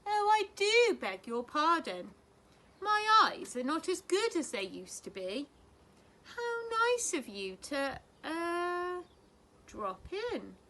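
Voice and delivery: put-on high, polite elderly lady voice; a fluttery, courteous granny; genteel old lady. A narrator's reading.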